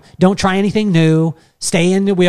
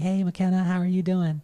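soft, slightly raised voice; a hint of a young boy saying hello; lightly voiced kid star; 3 semitones higher, 6 dB quieter than usual. Pop star greeting young fan on FaceTime, lightly imitated.

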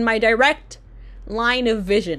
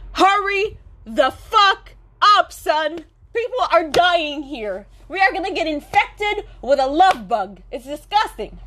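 impatient inner rant; 6 semitones higher than usual. Retold or imitated. Urging the barista in her head.

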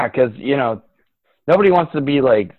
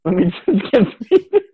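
laughing repeat; 7 semitones higher than usual. Real, not acted.

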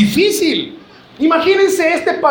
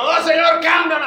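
mock tearful plea; 6 semitones higher than usual. Wife praying for a new husband, hammed-up tearful cry. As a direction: beg the Lord in tears. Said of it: "usted está llorando, va diciendo".

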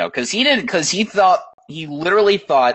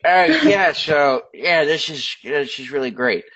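mock unimpressed; 4 semitones higher, slower than usual. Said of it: "I was like".

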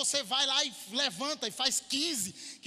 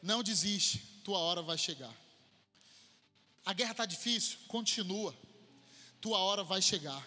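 lower, softer, repeated promise; intimate preaching; 8 semitones lower, 5 dB quieter than usual. Acted. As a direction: speak it gently into each person. Soft encouraging refrain. (pregação suave e íntima, voz baixa)

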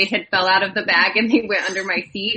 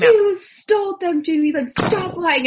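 higher, slow mock-angry accusation at her sister; retold angry accusation; 9 semitones higher than usual. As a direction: replay the raspberry outburst, pitched up and drawn out. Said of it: "And I was so pissed".

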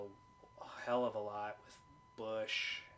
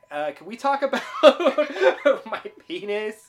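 loud, high quote breaking into laughter; giggly imitation of an awkward interruption; laughing quote; 12 semitones higher, 20 dB louder than usual. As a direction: crack up halfway through quoting the character. Quoting play, cracking up.

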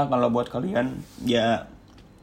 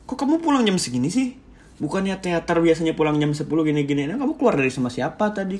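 higher-pitched questioning, mimicking an accusing partner; 4 semitones higher than usual. Partner grilling the speaker about coming home late, accusing. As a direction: imitate a suspicious partner's interrogation. Partner interrogates him, quoted.

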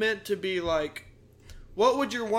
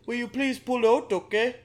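polite, higher mock-Canadian voice; comic Canadian girl, slightly louder; nice Canadian girl; 4 semitones higher, 5 dB louder than usual. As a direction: plead nicely in a broad Canadian accent. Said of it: "Canadians are nice".

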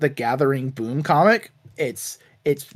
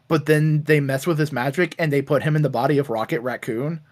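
normal talking voice

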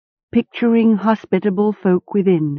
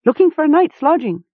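much higher and fast; hasty yelp; 10 semitones higher, faster than usual. A narrator's reading. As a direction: rush it out in a yelp. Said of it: "he yupped hastily".

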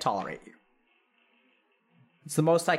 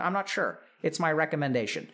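normal talking voice

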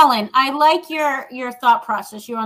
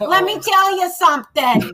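higher-pitched mock Bronx accent; imitated Bronx friend; 4 semitones higher than usual. Repeating a Bronx friend's catchphrase, higher mock Bronx accent. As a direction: play up the friend's Bronx talk. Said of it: "that's how she talks".

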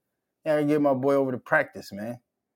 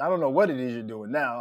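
usual speaking voice

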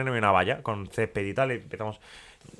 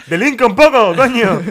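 high and loud, breaking into laughter; loud laughing punchline; 9 semitones higher, 10 dB louder than usual. Retold or imitated. Courier mimics the police punchline, loud and laughing. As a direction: shout the joke line while laughing.

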